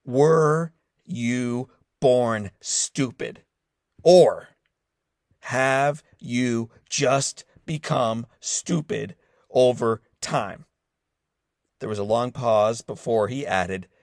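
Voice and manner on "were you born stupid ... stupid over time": very slow, drawn-out insult. Retold or imitated. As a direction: spell it out painfully slowly to mock. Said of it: "Let me slow down this question for you".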